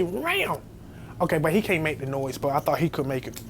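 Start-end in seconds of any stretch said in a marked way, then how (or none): 0.0-0.6 high imitated yowl; cat yowl. Asking his friend to make the cat noise, high yowl. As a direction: mimic the cat's cry. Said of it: "Can you say".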